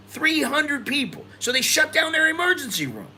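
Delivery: astonished exclamation; incredulous. Real, not acted. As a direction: stress how absurd it is. Stunned by hospital numbers.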